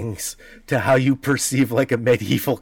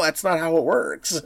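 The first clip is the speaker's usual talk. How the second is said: ordinary talk, nothing marked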